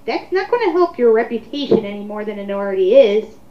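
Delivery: normal talking voice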